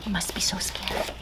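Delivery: put-on hushed whisper to the children; storyteller's secret whisper; whisper aside. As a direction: lean in and whisper.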